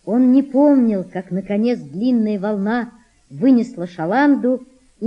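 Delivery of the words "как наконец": ordinary delivery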